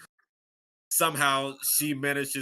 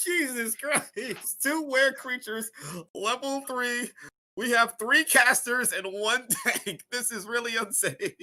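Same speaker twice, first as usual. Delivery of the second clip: high-pitched, laughing protest; incredulous outburst; 10 semitones higher, 3 dB louder than usual. Real, not acted. Player protests to game master about the fight, incredulous. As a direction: exclaim in real, half-amused alarm.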